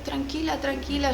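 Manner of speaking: imitating the shop girl soothing her softly. Clerk soothing her again.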